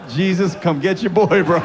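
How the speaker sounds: comic cry for help; mock pleading. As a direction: beg for rescue, half in jest. Mock plea to the cross.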